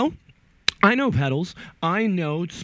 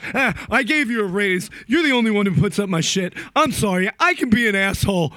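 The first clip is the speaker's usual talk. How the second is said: louder, higher boss, a touch whiny; a boss grudgingly apologizing while handing over a raise; imitating apologetic boss; 6 semitones higher, 4 dB louder, faster than usual. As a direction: play the boss gruffly sorry. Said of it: "comes back with my pay and goes".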